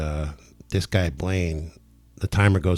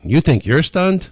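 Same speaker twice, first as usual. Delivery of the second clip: high, loud, drawn-out retold comeback; amazed retort; 7 semitones higher, 5 dB louder, slower than usual. Healer answering the stunned client, amazed retort. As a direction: throw it back at him, pitched up and incredulous. Retelling his own amazed reply.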